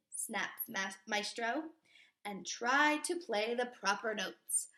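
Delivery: put-on lively read-aloud for kids; storybook reading. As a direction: read it to a class like a picture book. Reading picture book aloud.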